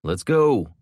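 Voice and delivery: loud, urgent call to leave; urgent shout. A narrator's reading. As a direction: hurry everyone out. Jason urges them to leave.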